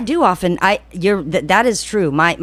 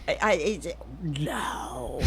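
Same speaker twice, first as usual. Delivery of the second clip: breathless whisper; mock whispered refusal; 12 dB quieter, much slower than usual. Crying parker refusing to apologise, in a whisper.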